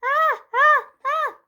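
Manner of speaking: high, loud playful scream; clown scream. Acted. Child scaring Grandma, high loud mock scream. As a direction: shriek high to scare Grandma. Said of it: "I screamed".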